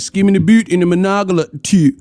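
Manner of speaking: mock Baltimore accent, fronted oo vowels. Streamer mimicking Baltimore haircut talk, mock Baltimore accent. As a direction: exaggerate the Baltimore vowels in BOOT and TOO. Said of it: "They be like".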